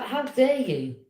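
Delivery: loud, indignant how dare you; retold furious comeback; angry retort. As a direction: snap it back at her in outrage. Retold row with mother.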